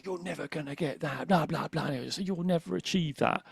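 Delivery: the inner critic telling him he'll never make it; old inner critic voice. Retold or imitated. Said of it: "Before my voice would say".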